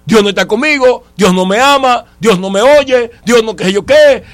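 usual speaking voice

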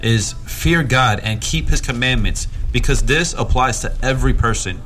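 firm, emphatic Bible reading; scripture reading. Acted. Preacher reads a closing verse to the congregation, scripture reading. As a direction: read the verse out with weight.

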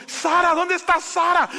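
put-on high, loud shout for a missing child; panicked calling. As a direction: call out across a big store in alarm. Father calls for lost daughter. (llamado a gritos, angustiado)

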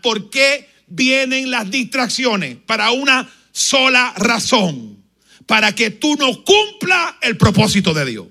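loud, slow, fervent preaching; a pastor warning his congregation, loud and fervent; preaching at full force. Real, not acted. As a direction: drive the warning home to the congregation.

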